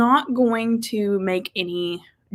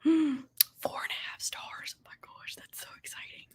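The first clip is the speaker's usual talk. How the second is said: excited whisper; 5 semitones higher, 12 dB quieter than usual. Real, not acted. Thrilled by a viewer's rating.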